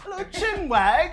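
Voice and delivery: very high mock-British squeal of a phrase; hammed-up British accent, high-pitched. Shown as a demonstration. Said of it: "give us your best British accent".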